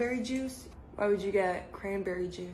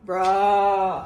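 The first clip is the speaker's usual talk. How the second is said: loud exasperated bruh; 10 dB louder than usual. Real, not acted. Exasperated reaction.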